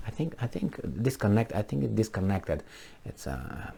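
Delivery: very soft, low muttering to oneself; trailing-off caller. Acted. Caller finding the representative's line disconnected, muttering softly. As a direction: let it fade away, puzzled. Pretends the phone call got cut off.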